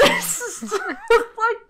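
laughing. Real, not acted.

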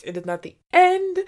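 high, loud thrilled reveal; squealing with delight; excited. Real, not acted. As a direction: announce it like a cliffhanger tease. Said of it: "oh, and I love this".

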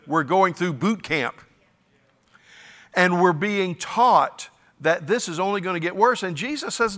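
ordinary talk, nothing marked

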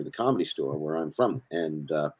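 usual speaking voice

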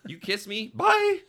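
high, loud mock alarm; hammed-up bailing. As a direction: squeal it and bolt, exploding on "Bye". Mock teen bailing after a kiss.

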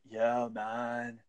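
low, laid-back dude-bro voice. Acted. Host offering his guest an American accent sample, mock dude-bro. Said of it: "Do you want the dude, bro".

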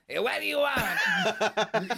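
high, slow, grumpy shout into the phone; annoyed phone answer. Retold or imitated. Worker answering his phone, mock annoyed shout. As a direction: bark it like a grumpy man picking up a call. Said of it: "He picks up his phone".

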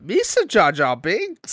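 high, loud, drawn-out goofy alien voice; mock the character's silly speech, slowly; slow, high-pitched cartoonish impression; Jar Jar Binks. Quotes Jar Jar's catchphrase, mocking.

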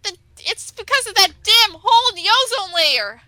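high-pitched comic indignation; mock outrage. Friend blaming ozone hole for hot summer, mock-outraged.

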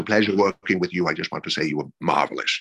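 lightly voiced gracious gentleman, pitched low; gracious Sidney Poitier. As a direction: offer low, courteous praise, lightly in character. Poitier's gracious parting words, retold.

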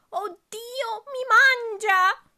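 high shrieking cry of terror, slow on mi mangia; terrified scream. Acted. Grandmother shrieking at the wolf, high terrified cry. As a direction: scream as if about to be eaten, with a cry. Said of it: "Gridò la poveretta".

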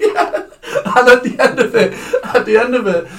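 high-pitched, laughing through the words; helpless laughter. Real, not acted. Telling co-host his exam blunder, laughing helplessly. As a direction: struggle to continue while cracking up.

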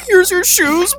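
high cackling voice on the words; imitated cackling. Grandpa handing shoes to crying child, imitated cackling. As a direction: squeeze the words through mocking glee. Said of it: "grandpa was literally cackling".

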